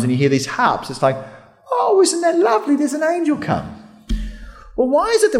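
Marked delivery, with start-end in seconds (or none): 1.7-3.8 high, sweetly admiring put-on voice; mock cooing. Movie watcher admiring an arriving angel, mock cooing. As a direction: coo dreamily over a lovely sight.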